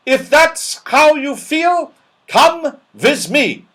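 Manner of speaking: put-on high, loud furious old dame; fierce loud snap. A narrator's reading. Cross dame catching naughty pixies, fierce and loud. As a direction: snap it out to scare the room. Angry dame orders them out.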